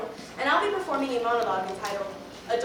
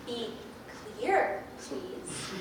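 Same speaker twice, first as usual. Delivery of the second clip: whispered plea; 8 dB quieter, much slower than usual. Acted. Exasperated plea to adults.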